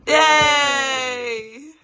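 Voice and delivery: loud laughing cheer; laughing shout of joy. Real, not acted. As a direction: whoop with joy. Dog wins, cheer.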